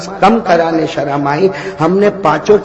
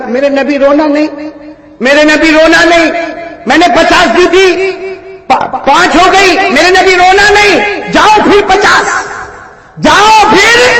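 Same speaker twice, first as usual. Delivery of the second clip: starts raised and climbs to a high, loud call; full-force preaching, building; 11 semitones higher, 6 dB louder than usual. Acted. Preacher voicing God comforting the Prophet, rising to a loud call. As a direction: build it up until the last words ring out.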